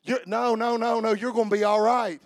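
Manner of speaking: normal talking voice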